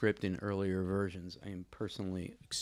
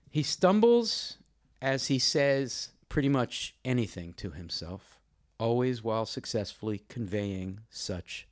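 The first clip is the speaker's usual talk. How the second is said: formal literary reading; 6 dB louder than usual. Real, not acted. Host reading a review to introduce the poet, formal emphatic reading. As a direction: read it out deliberately, with weighted key words and pauses.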